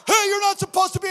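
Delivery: quick loud protest; indignant mom. Retold or imitated. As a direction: scold like an offended mom. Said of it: "The mom was wondering".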